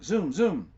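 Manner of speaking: loud, quick zoom. A narrator's reading. Storyteller zooming Jenny across the road. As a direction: zip it out.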